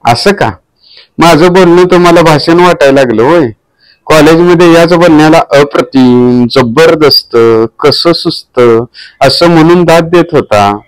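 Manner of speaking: a lightly played offended wife; voicing the wife. A narrator's reading.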